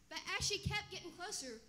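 ordinary talk, nothing marked

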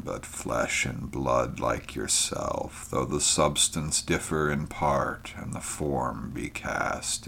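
soft, sorrowful old alien being, lightly voiced; a weary, gentle voice from another world; ancient creature. A narrator's reading. Ancient creature speaks to Conan.

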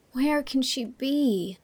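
slow, miserable question. Acted. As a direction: ask it slowly, lost and unhappy. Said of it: "said Anne miserably".

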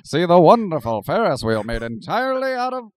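loud carnival barker. Acted. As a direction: sell the ride to the crowd. Continuing carnival barker joke.

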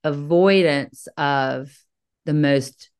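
normal talking voice

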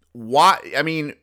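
usual speaking voice

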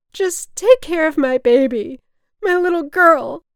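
high, wailing cry to a stranger; loud drunken plea. A narrator's reading. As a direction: beg at full voice, melodramatically. Drunk mother pleading from stretcher.

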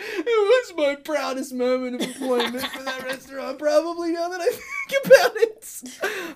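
high, loud, helpless laughing speech. Real, not acted. Host recalling his proudest moment at work, laughing. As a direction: barely get it out for laughing. Still cracking up at story.

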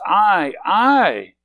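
"I, I" loud and high, each one stretched; emphatic stress on I. Acted. John protesting to Jesus, "I" doubly emphasized. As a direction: hammer "I" twice, the second swooping up. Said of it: "in the Greek it's emphasized twice".

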